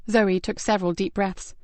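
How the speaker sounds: usual speaking voice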